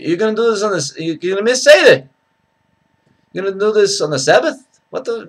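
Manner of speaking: high, exasperated Yiddish-inflected accent. Shown as a demonstration.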